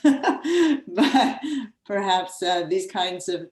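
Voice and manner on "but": breaking into a laugh. Real, not acted. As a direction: laugh through the word. Laughing at dead air.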